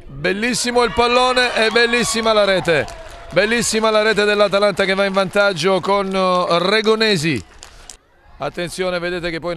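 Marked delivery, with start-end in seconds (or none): 0.1-7.4 excited goal call, louder; breathless italian goal commentary. Real, not acted. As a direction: shout the goal with joy. Goal scored, commentator erupts. (telecronaca del gol concitata e a voce alta)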